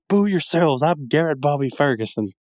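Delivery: Billy Mitchell impression. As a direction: do it as a smug champion. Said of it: "that right there is absolutely Billy Mitchell".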